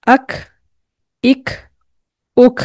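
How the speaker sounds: अख with air escaping after the stop; Qaf with breath. Shown as a demonstration. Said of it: "कैसे?".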